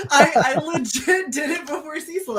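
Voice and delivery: high-pitched, laughing protest. Real, not acted. Guest claiming she did it before Cecil, laughing. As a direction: say it through real laughter.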